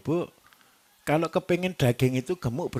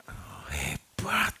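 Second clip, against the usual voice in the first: mock amazement; 10 semitones lower, 6 dB quieter, much slower than usual. Mock amazement at injected beef.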